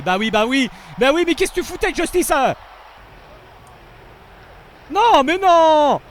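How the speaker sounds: loud high exasperated yell; angry yell. Real, not acted. Gamer yelling at his player Justice, angry exasperated shout. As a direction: scold the player at full voice.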